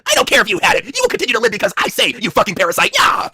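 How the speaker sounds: acted domineering fury; mock scolding. Wife berating her husband, mock furious. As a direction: berate with hammed-up contempt.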